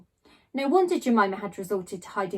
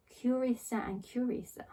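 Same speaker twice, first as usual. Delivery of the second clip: muttered under the breath, much softer; muttered to himself; 2 semitones higher, 9 dB quieter than usual. A narrator's reading. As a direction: murmur it to yourself, puzzled. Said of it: "he muttered to himself".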